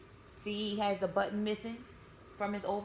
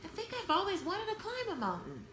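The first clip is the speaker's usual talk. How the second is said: dreamy bear; 9 semitones higher than usual. Acted. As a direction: wish aloud like a small toy bear. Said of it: "he wondered".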